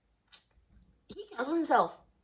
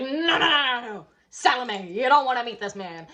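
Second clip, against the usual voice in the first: loud warning; 2 semitones higher, 6 dB louder than usual. Retold or imitated. Everybody warns Salome off.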